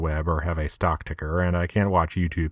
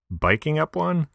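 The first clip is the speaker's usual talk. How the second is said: high, rising mock disbelief; incredulous question; 10 semitones higher than usual. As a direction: pitch it way up in disbelief.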